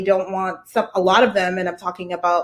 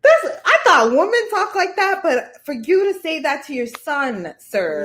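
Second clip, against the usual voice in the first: incredulous reaction; 8 semitones higher than usual. Retold or imitated. Woman reacting to what a father told his son, incredulous. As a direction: start high and taken aback, then come down. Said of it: "And I was like".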